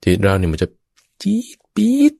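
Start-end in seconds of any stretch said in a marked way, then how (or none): 1.2-2.1 a mind flaring up, squeaked as high as he can. Shown as a demonstration. Monk shows the mind flaring, very high squeak. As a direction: shoot the voice way up into a tiny squeak. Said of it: "ข้าพเจ้าทำเสียงสูงได้มาก".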